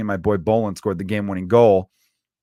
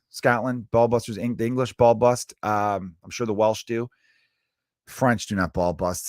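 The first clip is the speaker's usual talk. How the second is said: ordinary talk, nothing marked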